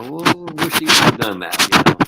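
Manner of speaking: high, loud, drawn-out groan of disapproving onlookers; hammed-up high exasperated sigh; mock dismayed fans. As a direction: slowly whine it like a disgusted crowd. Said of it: "people go".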